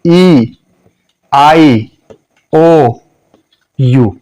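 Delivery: ई, आई, ओ, यू each drawn out as the letter's name; long vowels. Shown as a demonstration. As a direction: sound out ई, आई, ओ, यू as separate long vowels. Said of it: "ये long sound है". (लंबे स्वर)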